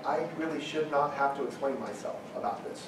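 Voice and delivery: put-on frustrated father talking to his kids, under his breath; exasperated parent. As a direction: mutter it like a tired parent. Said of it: "I'm like".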